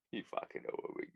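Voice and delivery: fast whispered mock aside. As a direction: hiss it out fast under your breath. Repeats catchphrase in put-on voices.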